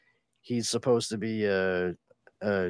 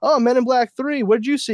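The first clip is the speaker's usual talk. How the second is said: calling out; 11 semitones higher, 6 dB louder than usual. Retold or imitated. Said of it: "I was like".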